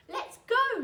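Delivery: high, loud shout; an excited young wolf shouting to the pack; eager shout. A narrator's reading. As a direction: call out to the pack, eager to lead. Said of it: "shouted Wilf".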